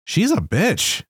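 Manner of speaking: tossing off a blunt verdict; imitating wife. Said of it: "my wife was just like".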